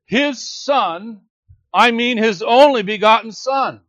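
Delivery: emphatic self-correction on "only begotten Son"; with emphasis. Shown as a demonstration. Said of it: "translated something like this with emphasis".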